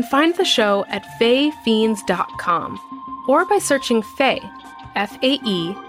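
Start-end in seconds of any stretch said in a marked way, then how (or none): none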